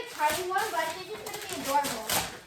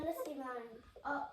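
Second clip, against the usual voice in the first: ordinary delivery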